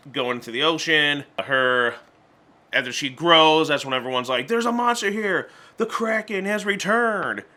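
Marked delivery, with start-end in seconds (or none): 4.5-7.4 mock alarm. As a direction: sound the alarm like a B-movie crowd. Townsfolk panic at monster.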